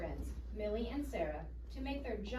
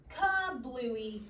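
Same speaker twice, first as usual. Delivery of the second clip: louder, punchy sound word; dramatic burst; 3 semitones higher, 6 dB louder than usual. Acted. Reading to children as the balloon bursts, louder. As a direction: bang it out like a balloon popping.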